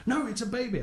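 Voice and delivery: high, whiny mock of a stubborn believer; mock insistence. Mocking the believers again.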